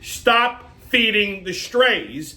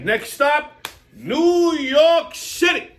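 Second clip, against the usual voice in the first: drawn-out conductor call, pitched up; train announcement; 8 semitones higher, 4 dB louder, much slower than usual. Pretend. Comic announcing the next stop like a conductor.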